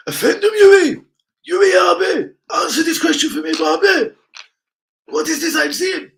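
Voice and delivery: calling out to Yui Abe in a high comic voice; put-on squeaky voice. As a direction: ham it up in a high silly voice.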